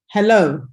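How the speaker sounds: "Hello" with LO stressed, falling at the end; second-syllable stress. Shown as a demonstration. Teacher repeating the example for students, "Hello" stressed on LO. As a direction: land firmly on the "lo" of "Hello". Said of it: "stress is on the second syllable".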